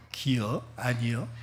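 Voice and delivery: quiet Chungcheong dialect demo; Chungcheong intonation. Shown as a demonstration. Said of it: "억양이 굉장히 중요합니다".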